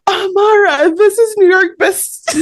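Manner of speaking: high, eager introduction; excited fan. Retold or imitated. Fan introducing her daughter to the author, high excited gush.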